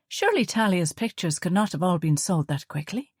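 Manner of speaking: ordinary delivery, nothing marked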